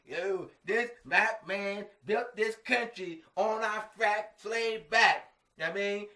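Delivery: mock Afrocentric man proclaiming, slow and loud; Afrocentric folks. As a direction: ham up an indignant Afrocentric speaker, drawn out and loud. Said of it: "Afrocentric folks, those, you know, Afrocentrics".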